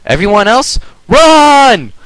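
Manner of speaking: urgent warning shout. Acted. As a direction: yell the alarm to everyone. Twilight warns friends to flee.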